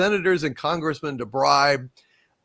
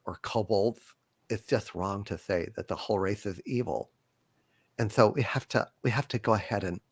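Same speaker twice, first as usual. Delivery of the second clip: low, soft, halting mealy-mouthed voice; trailing into a near-mumble; mock-sensitive hedging; 9 semitones lower, 4 dB quieter than usual. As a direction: tiptoe apologetically through it, quiet and low. Mocking the rule writers' hedging.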